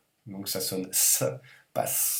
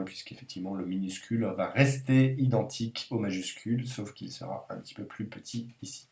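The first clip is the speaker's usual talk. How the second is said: ordinary talk, nothing marked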